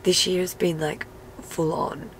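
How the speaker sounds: normal talking voice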